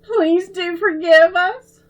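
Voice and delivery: put-on high, pleading little girl voice, on the edge of tears; tearful pleading. A narrator's reading. Little sister begging her brother's forgiveness, tearful and high. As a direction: beg forgiveness with a choked-up childish voice. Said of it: "Babs choked out a sob".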